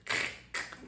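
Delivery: voiceless back-of-the-throat scrape; mock throat-clearing noise; imitated throaty rasp. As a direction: scrape out a phlegmy "kh" sound. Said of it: "any word with a lot of".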